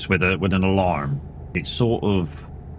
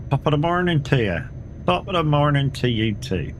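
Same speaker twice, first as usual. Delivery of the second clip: high, cheery bad Irish accent; 9 semitones higher than usual. Shown as a demonstration. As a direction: put on a hammy Irish accent. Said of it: "my Irish accent is shit".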